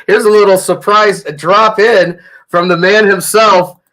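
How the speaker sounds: ordinary talk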